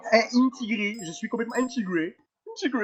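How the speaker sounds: high-pitched mocking imitation; mocking echo. Mockingly echoes the other player's claim. (imitation moqueuse, voix haut perchée)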